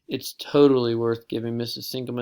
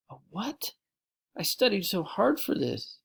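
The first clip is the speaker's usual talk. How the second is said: shocked protest; 8 semitones higher than usual. Acted. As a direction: plead that she studied. Shocked by a zero.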